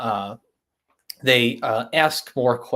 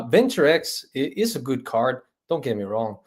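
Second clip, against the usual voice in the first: usual speaking voice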